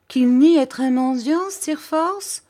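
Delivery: high, loud incredulous outburst; outraged cry. Acted. Headmaster exclaiming at his pupil in outrage. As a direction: cry out in disbelief. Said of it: "s'écria monsieur Creakle".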